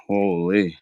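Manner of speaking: ordinary delivery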